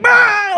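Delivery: high, loud burst of a yell; sudden high "Ah!" of mock hilarity; delighted mock scream. Reacting to his wank joke, delighted mock scream.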